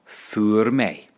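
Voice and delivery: «fuair mé» said slowly as "foor may"; slow, careful teacher model of «fuair mé»; slow model phrase. Shown as a demonstration. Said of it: "Just repeat that".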